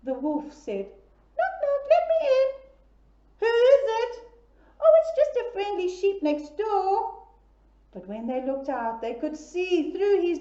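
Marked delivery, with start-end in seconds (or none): 1.4-2.5 high, sweet put-on wolf voice; wolf disguising himself to sound friendly; sweet disguised voice. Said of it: "with a sweet, disguised voice". 3.4-4.2 high wary piglet question; high piggy voice. Acted. Pigs answering the door. 4.8-7.2 high, sweet put-on wolf voice; wolf disguising himself to sound friendly; sweet disguised voice. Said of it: "with a sweet, disguised voice".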